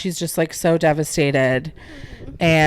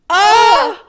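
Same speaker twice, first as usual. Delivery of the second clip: high, loud wail of heartache; real high-pitched "ah" of anguish; anguished cry; 8 dB louder than usual. Real, not acted. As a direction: cry out, overcome by the scene. Overcome retelling a sad scene.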